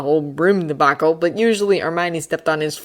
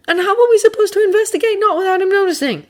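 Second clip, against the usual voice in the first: high, loud demanding voice; 4 dB louder than usual. Acted. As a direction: demand an answer. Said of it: "Draco demanded".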